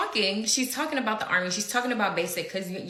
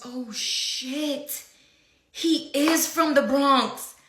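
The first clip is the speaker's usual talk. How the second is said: shocked coworker; 6 semitones higher, 4 dB louder, much slower than usual. Retold or imitated. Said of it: "So then she goes".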